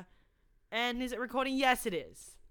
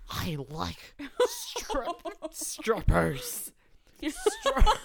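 silly voice; 8 semitones higher, 6 dB louder, much slower than usual. Acted. Silly voice, laughing.

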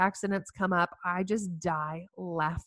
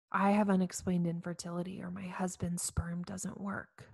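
whispered confession; 7 dB quieter than usual. Retold or imitated. Woman confessing infertility to others at the table, whispered. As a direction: barely breathe it like a shameful secret. Women sharing painful secrets at table.

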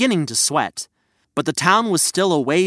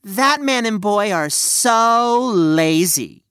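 slow, sneering and higher; 5 semitones higher, slower than usual. Acted. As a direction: drag it out with a sneer. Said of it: "she pointed with a sneer".

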